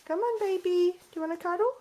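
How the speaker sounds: high coaxing pet voice. Real, not acted. Owner coaxing her bird into a cuddle, high baby talk. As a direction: coax the bird up high and sweet.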